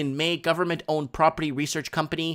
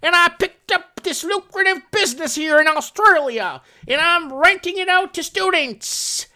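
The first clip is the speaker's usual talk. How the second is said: put-on Southern accent; 12 semitones higher, 6 dB louder than usual. Landlord bragging in a hammed-up Southern accent. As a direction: brag like a smug Southern landlord. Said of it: "I like to talk in a southern accent".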